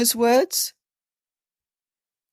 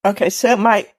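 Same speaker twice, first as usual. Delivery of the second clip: talking with food in mouth; mouth full; 2 semitones higher, 4 dB louder than usual. Real, not acted. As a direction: speak through a mouthful. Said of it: "I shouldn't have this in my mouth".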